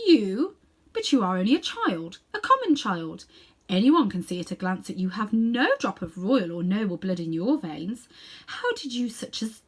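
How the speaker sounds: loud, haughty sneer; scornful queen. Acted. Queen scorns Diggory as a common child, loud and haughty.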